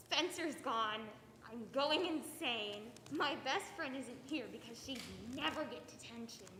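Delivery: ordinary talk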